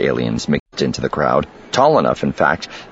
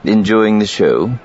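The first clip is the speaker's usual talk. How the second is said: casual taunt; 5 semitones higher, 4 dB louder than usual. A narrator's reading. Casual taunt at the man holding him.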